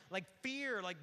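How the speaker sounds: normal talking voice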